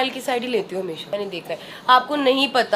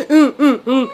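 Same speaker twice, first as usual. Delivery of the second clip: imitating baby's whimper; 7 dB louder, slower than usual. Mother imitating her baby's crying sound, mock whimper. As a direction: copy the odd little crying sound. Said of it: "different सा sound निकालती है रोने का".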